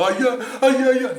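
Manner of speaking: high, very loud mock cries; imitated shouting. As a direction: holler like an overexcited fan spotting him. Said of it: "qui crient comme ça". (cris imités, très forts et aigus)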